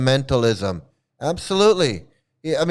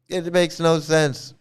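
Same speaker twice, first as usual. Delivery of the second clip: ordinary talk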